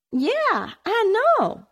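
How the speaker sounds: a small sister's quick agreement; girl agreeing. A narrator's reading.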